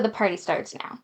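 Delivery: low, soft put-on guy voice; chosen guy voice. Shown as a demonstration. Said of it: "I'm gonna go with".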